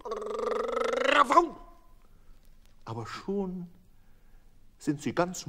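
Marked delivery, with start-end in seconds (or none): 0.0-1.6 high, loud barking yaps; yapping dog voice. A narrator's reading. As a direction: bark it like a little dog. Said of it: "und er bellt".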